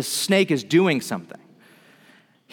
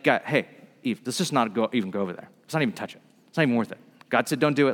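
lower, softer, hushed caution to his wife; a lightly voiced Adam keeping it low; Adam's hushed warning; 5 semitones lower, 4 dB quieter, much faster than usual. Adam quietly warning Eve to stay away from the tree, low and hushed.

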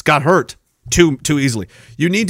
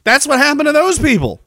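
ordinary talk, nothing marked

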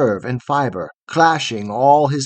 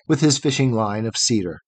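plain reading voice

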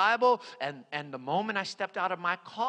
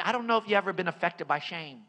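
ordinary delivery

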